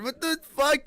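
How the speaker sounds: ordinary talk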